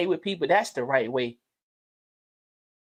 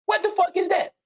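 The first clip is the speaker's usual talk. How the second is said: incredulous outburst; 10 semitones higher than usual. Real, not acted. Father scorning a womanizer upbringing, incredulous high outburst. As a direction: throw the question up in disgust. Rhetorical outburst about raising sons.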